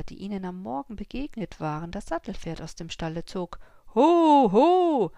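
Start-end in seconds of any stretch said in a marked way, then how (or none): 3.9-5.1 high, loud "Ho, ho"; loud call. A narrator's reading. Farmer calling out to the horse thief, loud and high. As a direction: bellow a warning. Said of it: "rief er".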